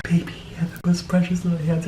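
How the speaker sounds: whisper. Acted. Tender whisper to baby-like hands.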